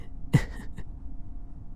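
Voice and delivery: faint airy laugh, barely there; a tiny hushed laugh; breathy soft chuckle. Acted. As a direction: chuckle under your breath. Teasing after the kidnapping question.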